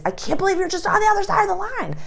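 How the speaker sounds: high, fast whiny protest; mock exasperated complaint. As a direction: rattle it off in mock disbelief. Repeating the yelled complaint.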